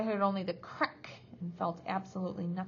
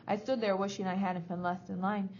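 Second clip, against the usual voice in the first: usual speaking voice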